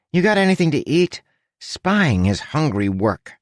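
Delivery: high, quick talking rat; chirpy little rat voice. A narrator's reading. Huck the rat speaking.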